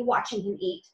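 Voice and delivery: ordinary delivery, nothing marked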